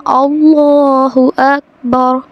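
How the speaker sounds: अल्लाहु slow and drawn out with heavy लाम; teacher's measured model of अल्लाहु अकबर; careful tajweed demo. Shown as a demonstration. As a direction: stretch अल्लाहु, then a neat अकबर. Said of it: "हमने इसको पढ़ना".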